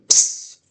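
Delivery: "ps" as a voiceless p-s cluster with no vowel; just the unvoiced "ps"; breathed ps sound. Shown as a demonstration. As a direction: demo of the bare "ps" sound. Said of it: "combination of a P S sound".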